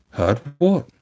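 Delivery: alarmed. A narrator's reading. Toad senses bad news and goes pale, alarmed. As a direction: snap back in alarm. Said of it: "turning rather pale".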